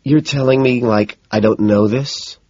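slow yawning words. A narrator's reading. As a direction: talk through a yawn. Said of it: "she yawns".

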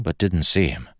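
plain reading voice

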